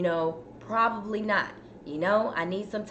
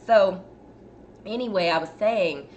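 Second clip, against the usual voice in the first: ordinary delivery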